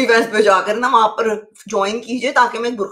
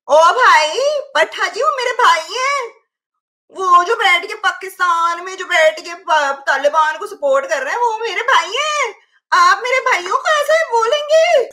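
mock indignant defence; 7 semitones higher than usual. Streamer defends Taliban supporters as her brothers, mock indignation. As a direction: rise to a hammed-up high wail of protest.

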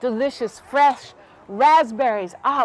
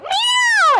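high, drawn-out meow; imitated startled meow; scared cat yowl; 4 dB louder than usual. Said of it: "that scared the little cat so much".

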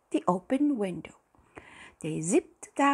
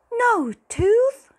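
very high, loud dismayed cry; crying out in shock; 11 dB louder than usual. A narrator's reading. Fairy crying out on reading there is no tooth. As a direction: cry out in disbelief. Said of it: "the tooth fairy cried".

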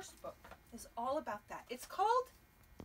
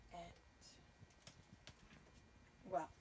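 ordinary delivery, nothing marked

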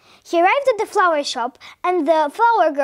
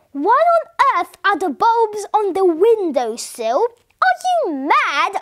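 slow, higher, exasperated questioning; incredulous; 5 semitones higher, 4 dB louder, slower than usual. A narrator's reading. Wife asking if her husband is mad, slow incredulous voice. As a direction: stretch the questions out in disbelief.

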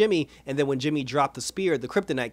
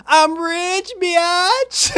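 much higher, louder, gloating mock brag; baller brag; 8 dB louder, much slower than usual. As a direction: crow it like a newly rich show-off. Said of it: "in the most baller way possible".

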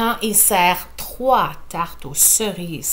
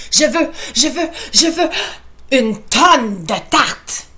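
higher, louder, slowing insistence; demanding child; 5 semitones higher, 4 dB louder than usual. Acted. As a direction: insist like a greedy kid. Enfant exige au concours. (enfant qui exige)

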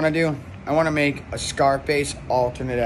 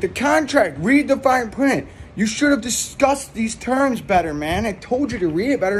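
mock lecturing hitman; 7 semitones higher than usual. Hitman scolding Tony about the contract, slightly higher. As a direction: talk down to him, a bit higher.

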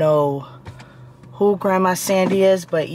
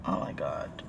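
breathless whisper, much lower and softer; a hushed, voiceless oh my God; whispered despair; 14 dB quieter than usual. Real, not acted. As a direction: whisper it in defeat. Frustrated over burned scalp.

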